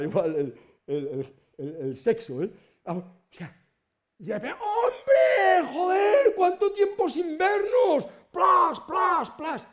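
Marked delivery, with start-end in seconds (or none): 4.6-9.7 very high, loud, drawn-out hearty greeting; joyful reunion shout. Acted. Friend greeting another at the resurrection with a very high hearty shout. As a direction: shout it like hugging a long-lost friend.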